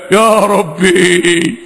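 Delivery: very slow, low, drawn-out plea; long, sunken cry to God; dismayed appeal. Real, not acted. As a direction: stretch it out low in disbelief. Dismayed sigh to God at atheists.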